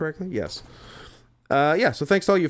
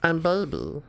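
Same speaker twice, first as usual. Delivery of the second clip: put-on baby voice; 5 dB quieter than usual. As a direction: talk like a baby. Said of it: "talking like a fucking baby".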